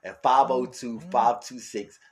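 high, loud hype ad voice; promo read. Acted. Host urging listeners to leave voicemails, high loud promo read. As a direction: pitch the voicemail like a radio promo. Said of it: "ad read for voicemail".

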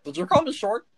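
a first bristling take at the word short; double-take, starting to bristle; offended. Acted. Gnome Stitch hears himself called short, bristling. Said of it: "Hang on a second".

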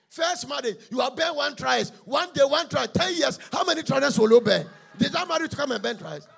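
high, loud scolding shout; husband yelling at wife over burnt trousers; angry husband. Acted. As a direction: berate her at the top of your voice.

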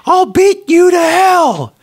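high, loud, slow bellow; furious shout. Acted. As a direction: roar it at the wife. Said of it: "A man yells at his wife".

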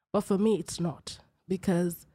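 ordinary talk

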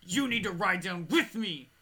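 loud, slow mock order; menacing demand. Woman orders guard into the elevator, menacing demand. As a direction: imitate a forceful, slowed-down demand. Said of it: "fucking death glares me and goes".

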